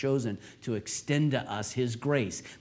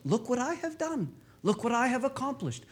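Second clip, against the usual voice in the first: mock braggart, pitched much higher; boastful; 14 semitones higher than usual. Pastor imitating a proud believer boasting about his works, mock boastful. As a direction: show off about what I have done.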